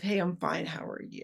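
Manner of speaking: quiet, tossed-off autopilot reply; perfunctory hello fading to a murmur; complacent greeting. Shown as a demonstration. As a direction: toss it away without caring. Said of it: "complacent, just show up of".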